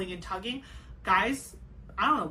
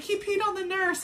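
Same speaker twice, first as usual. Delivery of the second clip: amused report; 10 semitones higher than usual. Retold or imitated. Quoting husband's funny report.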